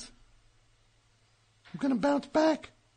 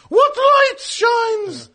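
slow, loud, higher theatrical declaiming; hammy Shakespearean actor; 5 semitones higher, 5 dB louder, much slower than usual. Acted.

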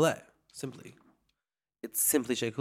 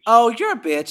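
higher, louder playful outrage; mock offense; 8 semitones higher, 8 dB louder than usual. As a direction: fake insult, teasing.

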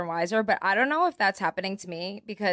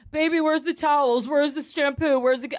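put-on higher, louder whiny boyfriend; needy boyfriend; 5 semitones higher, 4 dB louder than usual. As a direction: voice him whining for help. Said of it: "he's like".

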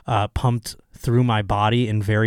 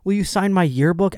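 fan voice; 8 semitones higher than usual. Retold or imitated. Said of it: "They have little yearbooks".

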